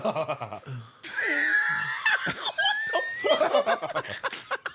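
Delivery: big, loud open chuckle; demonstrated chuckle. Shown as a demonstration. Man showing friends how men chuckle, exaggerated chuckle. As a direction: show off a hearty man's chuckle. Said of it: "That's a chuckle".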